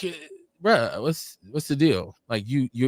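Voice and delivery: normal talking voice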